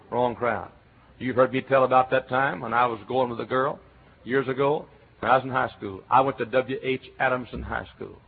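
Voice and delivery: usual speaking voice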